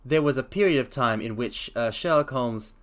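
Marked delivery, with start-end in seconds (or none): none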